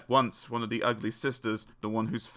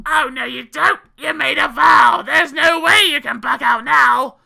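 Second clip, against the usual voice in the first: high, loud screeching objection; hammed-up furious shriek; indignant shouting; 14 semitones higher, 12 dB louder than usual. A narrator's reading. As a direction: shout him down as the ugly sister. Ugly sister insists on marriage.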